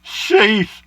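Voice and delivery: high, loud amazed sheesh. Real, not acted. Reacts to gas price.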